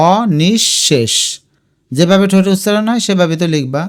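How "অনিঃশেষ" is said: অনিঃশেষ drawn out as o, nish, shesh; syllable by syllable. Shown as a demonstration. Teacher showing how to say it, অনিঃশেষ slowly split o-nish-shesh. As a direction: break অনিঃশেষ into slow separate pieces. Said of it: "আমরা উচ্চারণটা দেখো এভাবে করলাম".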